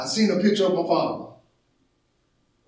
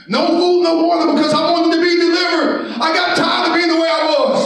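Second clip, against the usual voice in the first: high, shouted pulpit exhortation; impassioned, raised preacher voice; full-force preaching; 8 semitones higher, 4 dB louder than usual. Acted. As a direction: shout it out to the congregation.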